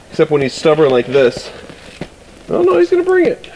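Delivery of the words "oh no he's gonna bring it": high excited burst; delighted squeal as he brings it; thrilled high voice. Real, not acted. Excited as dog returns.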